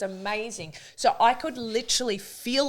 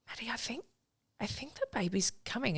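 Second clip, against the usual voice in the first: put-on softer, higher, urgent "the baby's coming"; hushed urgent warning; 5 semitones higher, 9 dB quieter than usual. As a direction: tell her quietly but urgently. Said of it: "And I was like".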